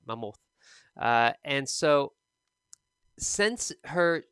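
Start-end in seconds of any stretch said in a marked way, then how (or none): 0.0-0.4 "mammoth" said as muh-MOOTH, second syllable stressed; student's misplaced stress. Retold or imitated. Teacher copies student's mistake, "mammoth" as muh-MOTH. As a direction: imitate the mispronounced "mammoth", stress shifted to the end. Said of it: "something like that".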